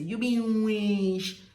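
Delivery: "witch" as loud, long "weesh"; drawn-out pronunciation. Shown as a demonstration. Showing how they draw it, loud and stretched. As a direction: draw "You be witch" out loudly. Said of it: "They draw it when they are saying it".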